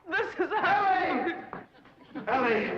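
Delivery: high, loud lovestruck cry; smitten cry. Acted. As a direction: gush her name like a lovesick swain. Charm makes him swoon.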